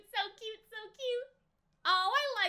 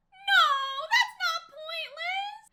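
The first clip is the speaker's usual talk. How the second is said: indignant protest; 6 semitones higher, 5 dB louder, slower than usual. Real, not acted. Fan protesting mean teacher on screen.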